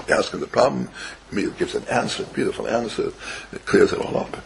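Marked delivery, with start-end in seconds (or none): none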